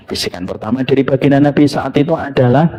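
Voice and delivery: ordinary delivery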